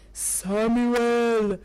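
slow, drawn-out low call; God's calling voice. Acted. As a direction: call the sleeper with long, even syllables. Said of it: "Samuel heard".

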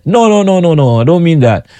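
high, rapid run of protest; insistent correction. Retold or imitated. Customers correcting his smart answer, mock insistent. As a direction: imitate someone waving off a wrong answer.

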